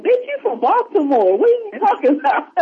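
high-pitched scoffing comeback; mock incredulous. Friend scoffing at worry over untinted windows, mock incredulous. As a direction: imitate calling out a friend's nonsense.

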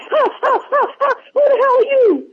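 very high mock-startled gasps then demand. Waking to a stranger in the room, very high mock startle. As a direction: gasp and demand who's there, high.

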